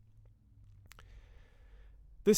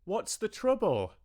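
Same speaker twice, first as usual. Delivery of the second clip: high, raised calling voice; full-blooded shout; 13 semitones higher than usual. A narrator's reading. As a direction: call out to someone hard of hearing. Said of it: "with a full-blooded shout".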